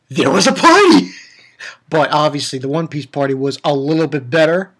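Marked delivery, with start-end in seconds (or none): 0.1-1.1 loud mock-excited announcement; hyped up. Shown as a demonstration. Vlogger comparing two anime parties, loud hyped repeat. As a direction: belt it out with comic emphasis on "party". Same words hyped up for contrast.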